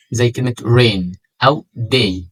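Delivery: rain and day with a long drawn ay, slowly; long ay sound. Shown as a demonstration. Teacher modelling long ay in rain, day. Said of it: "صوت طويل ومميز".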